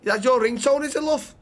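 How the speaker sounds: put-on Liverpool lilt; Scouse accent. Bystander praises woman's ringtone, in Scouse accent. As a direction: play an admiring Scouser. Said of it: "Scouse, okay".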